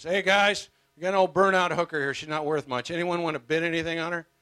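loud, high auctioneer call. Acted. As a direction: hawk her to the crowd. Auctioneer calling bids.

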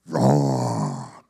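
low, rasping imitated roar; long growled roar; lion roar. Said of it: "a young lion meets you".

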